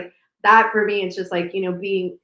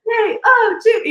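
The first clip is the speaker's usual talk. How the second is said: excited cheer; 7 dB louder, slower than usual. Retold or imitated. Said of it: "and they're like".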